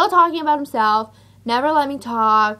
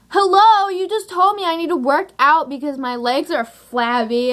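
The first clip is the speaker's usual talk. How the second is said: indignant retort; 5 semitones higher than usual. Retold or imitated. Acted angry retort to date.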